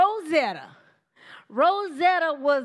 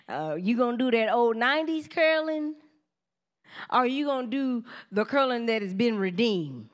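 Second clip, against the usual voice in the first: ordinary talk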